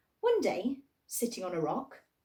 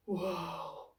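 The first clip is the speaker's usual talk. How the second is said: soft, awed breath; breathed whoa; 3 semitones lower, 5 dB quieter than usual. Acted. The voices marvel at Hester, soft and breathy. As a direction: breathe it out in wonder. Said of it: "breathed the voices".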